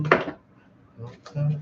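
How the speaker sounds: ordinary talk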